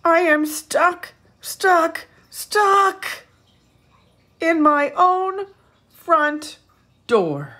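high, loud laughing wail of a stuck bear; stuck bear wailing at his own front door. Acted. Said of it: "Bear wails".

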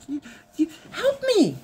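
high, whiny plea; pleading husband. Retold or imitated. Wife imitates husband begging for help, high whiny. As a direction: beg her like a small child.

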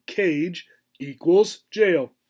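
slow, deliberate squirrel voice; squirrel's imagined verdict; squirrel's thought. Acted. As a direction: spell out the logic slowly.

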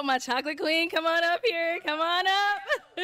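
rising, high-pitched host summons; playful, drawn-out invitation; calling up a contestant. Real, not acted. Host calls contestant up to join.

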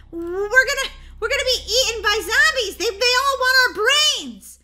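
high, loud stammering panic; panicked wail. Acted. Girl fearing zombies will eat them, panicked wail. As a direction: wail it out in hammed-up terror. Panics about being eaten.

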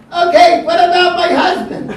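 high, loud, with a laugh; suddenly remember the husband, high and loud; old lady's voice. Retold or imitated. Old lady remembers her husband.

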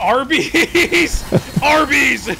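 ad slogan shout. Acted. Shouts ad slogan as move name.

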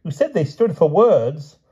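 high, loud, indignant rebuttal; protesting king. A narrator's reading. King throws the knight's words back, high and protesting.

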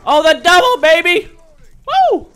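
high, loud victory whoop; loud high gamer celebration; triumphant cheer. Real, not acted. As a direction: celebrate the kill at full voice. Gets a double kill.

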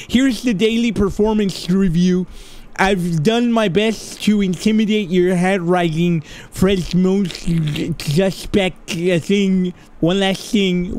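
lisping, whistly character voice, squeaked thanks at the start; toothless Yellowhead. Acted. Yellowhead helping the player escape, lisping through missing teeth. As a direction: voice a game character with barely two teeth. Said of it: "I bet you talk like this".

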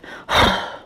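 loud huffy exhale; huffy breath. A narrator's reading. Girl reacts to a crazy idea with a huffy breath. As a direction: huff out an annoyed breath. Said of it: "I did a huffy breath".

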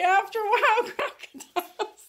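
high, playful voice breaking into laughter; laughing dog sign-off. Pretend. Owner gives the dog's goodbye rhyme, high and giggly. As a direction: pitch it way up and laugh through it.

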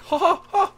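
very high, loud squeaky panting; Mickey Mouse. Retold or imitated. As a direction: squeal out cartoon-mouse gasps. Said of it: "maybe it's Mickey Mouse having sex".

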